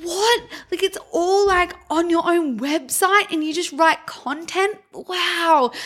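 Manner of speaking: high, drawn-out incredulous What and Wow; mock amazement. Client stunned that they still blog, in mock amazement. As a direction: play the baffled client gushing in disbelief. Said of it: "But they're like".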